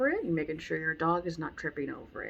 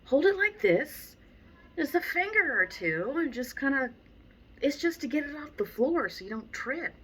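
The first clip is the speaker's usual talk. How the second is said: higher, exasperated scolding of a student; a trainer scolding a student holding the leash wrong; 7 semitones higher than usual. Retold or imitated. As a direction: fuss at them in disbelief. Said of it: "I'm like".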